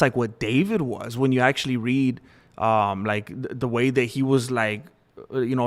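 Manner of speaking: ordinary talk, nothing marked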